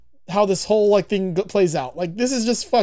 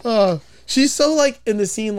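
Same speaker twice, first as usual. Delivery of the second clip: usual speaking voice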